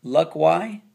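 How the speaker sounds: "Luck Y" with a rising, questioning why; wrong Y sound. Shown as a demonstration. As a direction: misread lucky as "Luck Y", as a question. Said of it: "How do you say that?".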